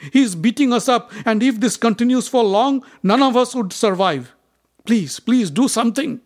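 high, loud, desperate plea; frightened pleading. A narrator's reading. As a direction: beg the king in panic. Frightened serpents plead to king.

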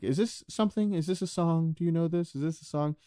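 wondering [name]. Retold or imitated. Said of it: "he was just like".

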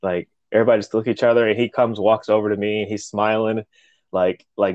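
usual speaking voice

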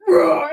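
sudden loud mock roar; imitated angry roar. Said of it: "after he yells at me".

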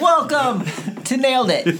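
slow, high announcer-style intro; podcast intro voice. Acted. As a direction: announce the show name with flair. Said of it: "See, you're good at that".

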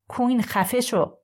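shouted order. A narrator's reading. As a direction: bark the order to make him stop. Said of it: "داستی داد زد".

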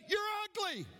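very high answering call; echoed holler. Acted. Echo answering the boy's insult, high holler. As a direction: mimic the high taunt as an echo. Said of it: "And the voice said".

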